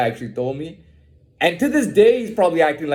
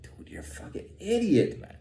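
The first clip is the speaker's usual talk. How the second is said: hushed, disbelieving whisper; whispered mock put-down; whispered retort; 6 semitones higher, 9 dB quieter than usual. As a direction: whisper the insult under your breath. Whispered retort to imagined accuser.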